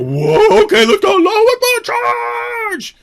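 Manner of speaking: huge high shouted charge; mock battle cry. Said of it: "They go".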